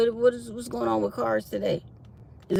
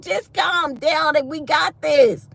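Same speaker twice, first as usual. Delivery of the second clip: raised, slow, insistent voice; imitated firm reassurance; 9 semitones higher, 6 dB louder, slower than usual. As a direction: talk firmly over someone's panic. Retold telling off a panicking coworker.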